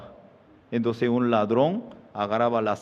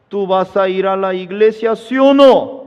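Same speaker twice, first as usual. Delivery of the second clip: loud, high yes-or-no demand; demanding shout; 7 semitones higher, 9 dB louder than usual. Acted. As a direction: bark the question at her. Husband demands an answer.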